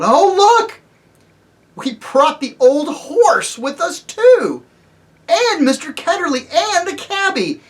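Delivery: high, loud excited exclaiming; crying out. Acted. Polly crying out on seeing the horse, high and loud. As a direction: burst out in surprise. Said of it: "Polly cried out".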